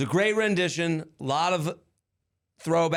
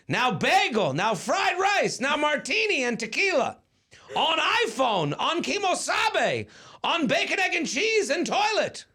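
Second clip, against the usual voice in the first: calling out; 10 semitones higher than usual. Acted. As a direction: call each name out across the sky. Calling reindeer names in poem.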